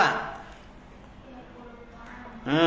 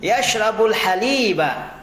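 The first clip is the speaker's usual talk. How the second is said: model reading; 4 semitones higher than usual. Shown as a demonstration. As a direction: read it out for the class to copy. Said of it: "Yang kedua". (bacaan contoh yang jelas)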